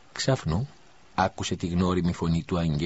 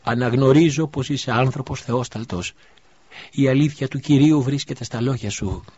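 higher, louder fervent kneeling cry; 7 semitones higher, 7 dB louder than usual. A narrator's reading. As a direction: proclaim it on your knees. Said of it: "άρχισε να φωνάζει". (φωνάζει γονατιστή, πιο δυνατά)

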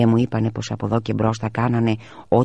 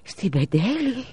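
high, slow dismayed gasp; aghast; 13 semitones higher, much slower than usual. A narrator's reading. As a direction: turn pale as you ask it. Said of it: "χλώμιασε η μαμά". (τρομαγμένη ερώτηση, ψηλά και αργά)

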